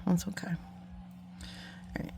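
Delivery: soft focused whisper. Real, not acted. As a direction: whisper while concentrating. Whispered while concentrating on task.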